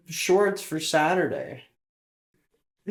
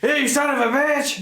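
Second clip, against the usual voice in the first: excited yell; 10 semitones higher, 4 dB louder than usual. Retold or imitated. Echoing dad's outburst.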